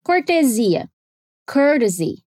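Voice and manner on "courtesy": careful "courtesy", t lightly tapped; flap T. Shown as a demonstration. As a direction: say "courtesy" American style, like "curdesy". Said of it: "Vamos treinar mais algumas palavras".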